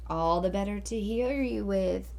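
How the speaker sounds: wolf lightly faking grandma; a hint of an old granny voice; disguised voice. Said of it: "disguising his voice".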